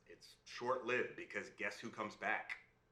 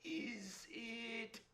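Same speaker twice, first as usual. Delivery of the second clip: hesitant princess; 11 semitones higher, much slower than usual. Acted. Princess guessing the imp's name, high and hesitant. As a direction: stretch it out high and tentatively. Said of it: "And she guesses".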